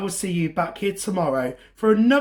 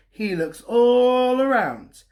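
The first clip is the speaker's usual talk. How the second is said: slow, stretched refrain; drawn out; 6 semitones higher, 4 dB louder, slower than usual. A narrator's reading. Storyteller stretching the refrain for young listeners. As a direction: draw out the suspense.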